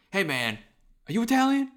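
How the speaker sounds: lightly acted mock exchange between buddies, pitch jumping high on the question; mock Italian-heritage bros. Friend teasing how Italians bond, mock buddy voices. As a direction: play two instant pals bonding, lightly mocking. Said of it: "what people who have Italian heritage do".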